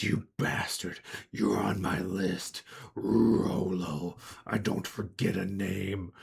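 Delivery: slow, husky monster threat; vengeful creature. Acted. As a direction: rasp out a grudge word by word.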